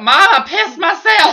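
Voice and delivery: high, loud, drawn-out mock yell; exasperated shout. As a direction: blurt it out at full volume, half laughing. Repeats confession loudly to Mom.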